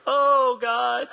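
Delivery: high, loud, hammed-up whimper to God; mock sob story. Pastor mocking Elijah's complaint to God, hammed-up whining. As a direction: overplay a self-pitying wail. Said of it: "really sad sob story".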